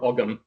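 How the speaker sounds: Ogham with a rounded "aw" before the g; alternative pronunciation. Shown as a demonstration. Offering another variant to listeners, Ogham as "awg-um". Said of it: "also about the pronunciation of".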